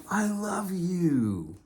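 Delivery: very high, loud, drawn-out mock gushing; hammed-up declaration. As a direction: stretch it out in a silly high voice. Hammed-up declaration to his critics.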